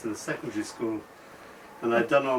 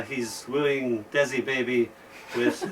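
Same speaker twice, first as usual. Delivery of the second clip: ordinary delivery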